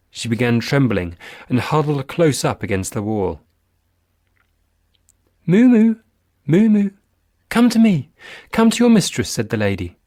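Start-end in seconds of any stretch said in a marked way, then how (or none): 5.5-9.3 high, sweet coaxing call to a frightened dog. Acted. Old lady coaxing her frightened dog in high sing-song. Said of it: "in her coaxing voice".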